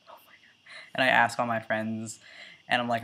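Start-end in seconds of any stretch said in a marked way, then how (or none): 0.1-0.9 breathless whisper of panic. Retold or imitated. Panicking about balding, voiceless whisper. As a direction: hush it out like a secret dread.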